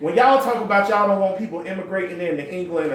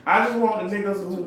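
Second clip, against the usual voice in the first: ordinary talk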